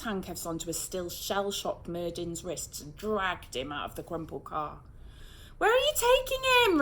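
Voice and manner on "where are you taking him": high, loud anguished wail. A narrator's reading. Girl wailing as police take Murden, high and loud. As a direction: cry out in protest, much higher. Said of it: "Rose wailed".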